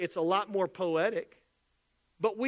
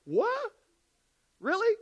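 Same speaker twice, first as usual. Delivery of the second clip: much higher, stunned disbelief; shocked surprise; 12 semitones higher than usual. Retold or imitated. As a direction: gasp it out like a baffled first-time buyer. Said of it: "They're like".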